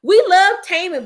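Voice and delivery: loud high fan declaration; loud high fan cheer. Real, not acted.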